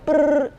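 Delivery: high, loud lip-trill beat noise; trilled brrr. Retold or imitated. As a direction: buzz the lips like a drum roll. Beat sound between name ideas.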